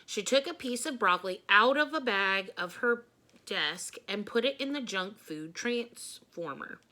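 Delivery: ordinary delivery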